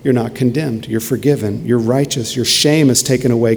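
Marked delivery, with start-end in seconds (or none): none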